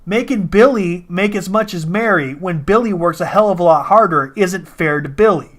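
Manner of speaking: ordinary talk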